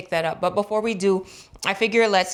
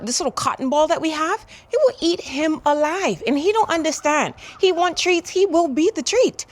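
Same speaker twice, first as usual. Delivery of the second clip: high, cooing pet talk; 8 semitones higher than usual. Real, not acted. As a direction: coo at the little dog. Talking about the dog.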